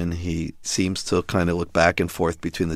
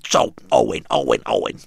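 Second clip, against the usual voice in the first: Gilbert Gottfried as Iago. Retold or imitated. Father talks to his son as a puppet, squawky parrot impression. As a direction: do a rasping, halting cartoon parrot voice. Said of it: "It's Gilbert Gottfried's voice".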